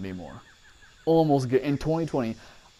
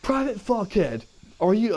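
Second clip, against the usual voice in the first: drill sergeant impression; 8 semitones higher than usual. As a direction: ham up the abusive drill sergeant. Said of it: "so he goes".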